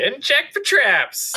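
high, loud, slow mocking echo. Real, not acted. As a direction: throw her words back, teasing. GM echoes player mockingly.

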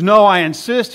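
firm insistence. Retold or imitated. Neighbor insists, voiced.

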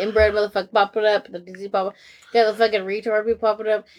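fast, breathless run of words; fast rambling list; rapid-fire rant. Real, not acted. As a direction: rattle it off like an auctioneer. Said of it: "You sound like a fucking, like, an auctioneer".

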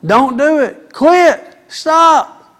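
loud, high preaching, hammering each point; full-force sermon voice; fiery preaching. Acted. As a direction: preach it hard at the men. Preacher commanding the congregation.

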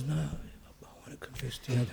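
whispered imitation of blissful calm; serene whisper. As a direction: whisper it as the floating, peaceful penitent. Acting out the serenely peaceful confessor.

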